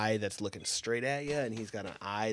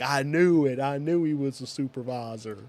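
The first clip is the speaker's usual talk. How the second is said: smug gotcha; 5 semitones higher, 7 dB louder than usual. Retold or imitated. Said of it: "he's gonna be like".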